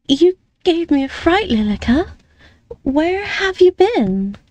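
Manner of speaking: high, breathless after a fright; startled young lady. Acted. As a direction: still shaken, ask where she was. Amelie startled, recovering.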